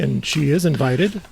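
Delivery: ordinary delivery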